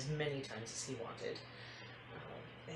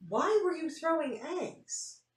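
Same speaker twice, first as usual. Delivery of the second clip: incredulous mom; 12 semitones higher, 6 dB louder, slower than usual. Acted. Mom questions daughter, rising disbelief.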